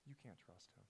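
"You can't trust him" in a low hushed voice; Satan murmuring. Retold or imitated. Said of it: "you can hear Satan say".

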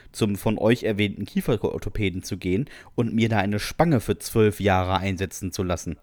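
usual speaking voice

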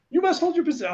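high, strained command; mock order. Controller ordering the landed pilot, high mock command. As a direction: snap the order like an alarmed controller.

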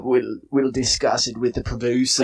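light mock British reply; a touch of a prim British official; British accent. Host answering in a light mock British accent. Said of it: "speaking in British accents".

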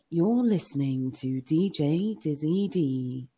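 slow radio-drop announcer voice; DJ intro. Retold or imitated. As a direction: draw it out like a DJ's station tag. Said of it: "So his".

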